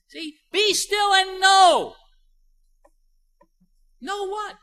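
high, slow, shouted declaration; shouting the verse to the congregation; preached proclamation. Acted. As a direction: thunder it out slowly from the pulpit.

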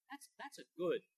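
ordinary talk, nothing marked

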